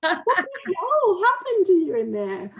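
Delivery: high, loud outburst of retold alarm, almost crying; shocked outcry. Retelling her shocked reaction to a friend, loud high outcry.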